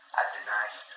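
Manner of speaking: hushed, low confession; acted penitent, very soft and halting; quiet remorse. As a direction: confess it softly, voice sinking. Peter realizes his denial to Jesus.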